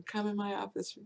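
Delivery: ordinary talk, nothing marked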